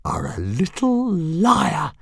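menacing dragon hiss. Acted. Fireworm threatening Toothless, slow hiss. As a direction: hiss it like a leopard about to pounce. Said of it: "hissed Fireworm".

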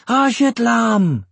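high, loud, drawn-out cry of wonder; amazed exclamation. A narrator's reading. Amazed dwarf children recognise Eragon, exclaiming. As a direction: call it out like thrilled children. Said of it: "they exclaimed".

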